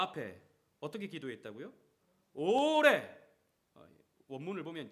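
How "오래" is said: 오래 shouted high and long; emphatic shout. Real, not acted. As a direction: hammer the word 오래 home. Preacher stresses key word.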